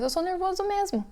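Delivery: high, lightly voiced child giving in; a hint of a kid shrugging it off; resigned child. Child resigned to being called nervous, voiced high and light. Labelled child accepting label.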